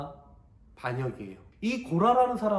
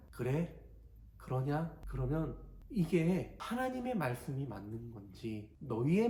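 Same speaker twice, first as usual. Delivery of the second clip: softer, lower, measured reply; calm Moses; 3 semitones lower, 6 dB quieter than usual. Acted. Moses answering Korah's rebellion, quiet and calm. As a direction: answer the rebels calmly and steadily. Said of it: "근데 모세는 그렇게 하지 않아요".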